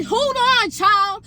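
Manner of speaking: loud, high halt; emphatic interjection. Retold or imitated. Cutting off an angry friend, emphatic interjection. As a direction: call it out like a scolding preacher.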